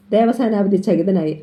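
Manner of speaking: usual speaking voice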